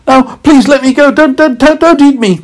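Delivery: high, loud pleading carrot voice; begging carrot. Acted. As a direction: beg for your life in a squeaky voice. Carrot begs boy.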